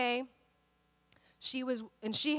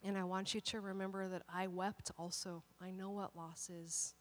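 gentle voice of God; 5 semitones lower, 12 dB quieter than usual. Retold or imitated. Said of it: "God was like".

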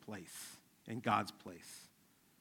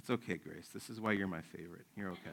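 low, soft reassurance; soothing dad; 5 semitones lower, 6 dB quieter than usual. Retold or imitated. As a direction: imitate gently calming an over-excited child. Dad soothing his little daughter.

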